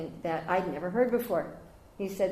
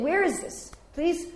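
high, pressing demand for the source; mock demand for a source; 10 semitones higher, 4 dB louder, slower than usual. Shown as a demonstration. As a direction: ask it high and insistent. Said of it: "You say".

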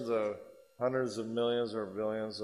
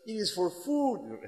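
put-on French accent; 10 semitones higher, slower than usual. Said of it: "heavy French accent".

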